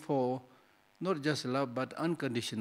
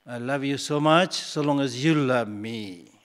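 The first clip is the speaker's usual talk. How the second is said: mock business lover; 7 dB louder, slower than usual. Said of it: "This is business love".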